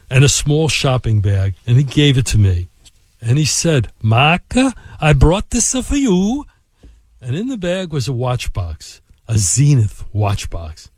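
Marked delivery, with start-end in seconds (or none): 4.0-6.4 imitated Italian accent, slow and lilting; stretched-out mock Italian accent. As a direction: put on an Italian executive's voice, rising at the end. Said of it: "in this accent".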